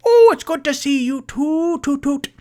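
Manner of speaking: very high, loud delighted halfling host; cheery halfling. Acted. Mister Peabody greeting returning guests at his door, very high and delighted. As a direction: squeal a bright welcome up high.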